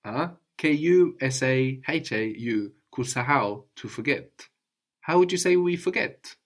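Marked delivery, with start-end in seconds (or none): 0.6-2.7 slow letter-by-letter spelling; spelled out letter by letter. Shown as a demonstration. Teacher spelling the verb out slowly for the student. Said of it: "spelling out the verb".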